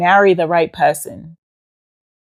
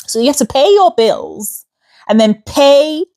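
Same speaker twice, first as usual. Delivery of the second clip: normal talking voice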